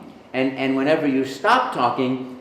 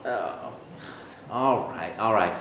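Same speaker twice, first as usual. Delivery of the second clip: reluctant; 5 semitones lower, 7 dB quieter, slower than usual. Retold or imitated. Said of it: "And he goes".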